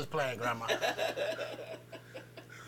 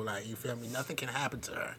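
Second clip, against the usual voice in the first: much softer, low, careful warning; soft serious tone; 7 semitones lower, 16 dB quieter than usual. Retold or imitated. Warning the daycare teacher in a soft, creepy tone. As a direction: say it low and measured so it lands. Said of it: "That sound a little creepy".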